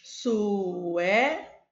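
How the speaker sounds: Sué- said loud and held, cut before the end; split syllable. Shown as a demonstration. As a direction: hold out Sué- to show the syllable. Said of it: "Suécia".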